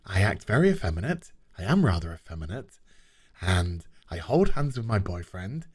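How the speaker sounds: low, fast, hammed-up smug doctor voice. Mocking the smiling doctor's self-description, low and fast. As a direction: rattle it off like a vain caricature.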